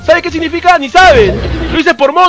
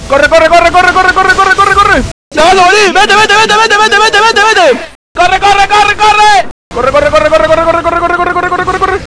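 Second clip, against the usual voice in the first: being chased; 4 semitones higher than usual. Real, not acted. Fleeing danger in a game.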